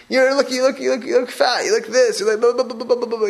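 imitating someone picking at a spouse; mock berating. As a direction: rattle off a hammed-up scolding. Acting out berating a spouse over looks.

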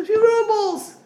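a high, put-on voice of the borrower; pleading friend. The friend on the street begging a few rubles, high and pleading. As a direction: squeak out the small request.

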